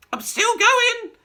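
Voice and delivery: high, loud, slow screech; screechy old grandma crowing. Acted. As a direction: screech it down from the attic. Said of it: "the old screechy voice".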